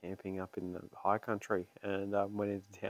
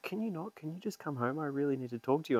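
higher, pleading "can you just come home"; urgent partner; 6 semitones higher than usual. Retold or imitated. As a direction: press him to come home now. Partner urging him home.

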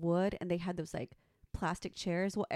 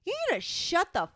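raised, higher mock snap at the lady; retold angry clapback; 6 semitones higher, 5 dB louder than usual. Retelling how she told off the old lady, angry raised voice. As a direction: reenact telling her off, loud.

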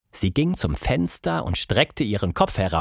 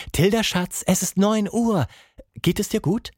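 put-on higher, caring mother waking her child; mother's voice; 8 semitones higher than usual. Mother waking Tilda, gentle higher voice. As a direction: gently wake your sleepy daughter.

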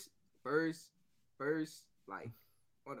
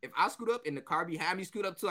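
usual speaking voice

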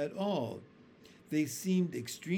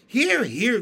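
loud, high cheer; cheering shout; 8 semitones higher, 12 dB louder than usual. A narrator's reading. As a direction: cheer it loudly. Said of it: "they all shouted".

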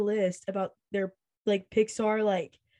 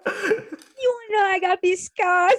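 high, loud, slow villain taunt; drawn-out, over-the-top Joker impression; loud hammed-up supervillain menace; 10 semitones higher, 5 dB louder, slower than usual. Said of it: "You're like the Joker?".

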